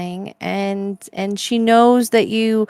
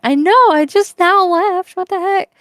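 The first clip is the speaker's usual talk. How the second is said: exasperated; 9 semitones higher, 5 dB louder than usual. Retold or imitated. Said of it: "I was like".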